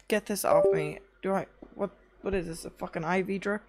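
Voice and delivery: normal talking voice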